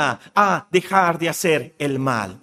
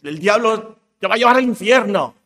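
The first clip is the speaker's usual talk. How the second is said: mock threat; 5 semitones higher, 5 dB louder, faster than usual. Voices the threat about the devil.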